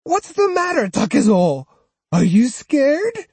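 high, loud mocking taunt; taunting cry. Old woman jeering at the swordsman, high loud taunt. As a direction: jeer at him. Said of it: "Osugi cried".

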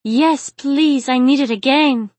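high, loud, needy begging; whimpering plea. A narrator's reading. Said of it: "Chai whimpered".

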